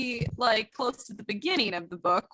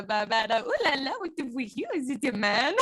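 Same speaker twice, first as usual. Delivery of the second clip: deliberately bad mock French accent; a cheesy French accent on purpose; 3 dB quieter than usual. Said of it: "that was my bad one".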